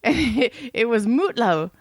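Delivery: ordinary talk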